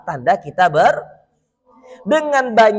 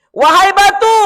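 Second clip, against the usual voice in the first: calling out; 10 semitones higher, 7 dB louder than usual. Acted. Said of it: "kata Rasulullah".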